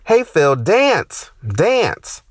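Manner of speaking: high, loud call to dance; mock receptionist call. Receptionist yelling down the hallway, mock high call. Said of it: "And she says".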